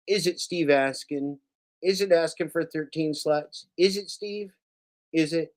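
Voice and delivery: high, soft teasing questions; mock innocent taunt. Mocking taunt at Steve.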